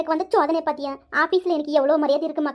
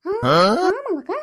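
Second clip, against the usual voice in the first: mock shocked wail; much slower than usual. Shocked by gossip.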